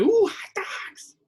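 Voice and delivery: high, slow delighted coo; childish delight. Retold or imitated. Kid told there'll be hot dogs, high delighted coo. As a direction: coo it like a thrilled little kid. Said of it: "And I would be like".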